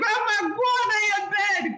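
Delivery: Jamaican Patois. Shown as a demonstration. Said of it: "So very good".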